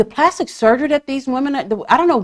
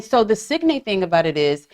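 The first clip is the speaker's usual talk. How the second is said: normal talking voice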